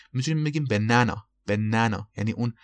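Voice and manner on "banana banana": banana with an American short 'a' as in cat; American pronunciation. Shown as a demonstration. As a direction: say banana the American way, flat-A middle syllable. (تلفظ امریکن banana با فتحه)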